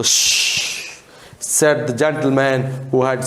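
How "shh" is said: shushing. Acted. Gentleman hushes room.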